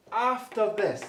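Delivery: "After this" set apart, pitched high and loud; emphatic "After this". Real, not acted. Teacher pointing his class to verse seven, emphatic "After this". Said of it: "I'm putting the emphasis on after this".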